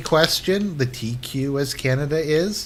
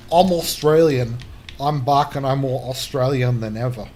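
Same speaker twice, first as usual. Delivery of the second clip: hammed-up Aussie accent; mock Australian accent. Film's running joke about an Australian, mock Aussie accent. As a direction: imitate a caricature Australian. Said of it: "there was an Australian guy".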